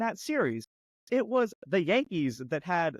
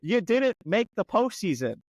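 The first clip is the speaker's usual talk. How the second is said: taunting; 4 semitones higher, 4 dB louder than usual. Real, not acted. Continued mocking of Yankees.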